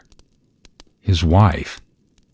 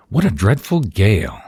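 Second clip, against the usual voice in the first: lightly voiced, higher-pitched wife; Madame Albert; 5 semitones higher, 3 dB louder than usual. A narrator's reading. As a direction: exclaim as a wife rattled by the storm. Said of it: "said Madame Albert".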